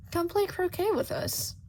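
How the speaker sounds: high, commanding royal voice; imperious queen. Acted. Queen ordering Shota to croquet, high and haughty. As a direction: summon him like a haughty queen.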